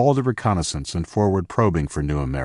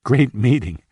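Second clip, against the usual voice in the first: mocking repeat; 5 semitones higher, 3 dB louder than usual. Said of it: "how lame that attempt at making small talk was".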